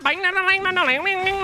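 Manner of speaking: high, twangy banjo plucking noises; rapid nasal banjo imitation; imitated banjo. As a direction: twang out a banjo riff by mouth. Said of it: "some guy with a banjo".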